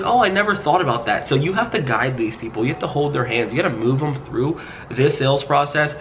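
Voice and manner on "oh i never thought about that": higher, dawning realization; surprised client. Retold or imitated. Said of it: "She's like".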